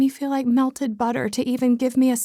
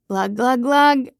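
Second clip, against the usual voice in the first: mock pouring sound; slower than usual. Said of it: "I'm spouting more wine into my glass".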